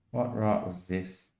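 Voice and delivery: a soft, muttered echo; hushed repeat. Retold or imitated. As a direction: let it drop to a near-murmur. Repeated line, much quieter.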